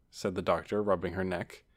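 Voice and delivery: ordinary delivery, nothing marked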